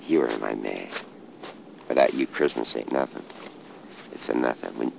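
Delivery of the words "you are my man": ordinary talk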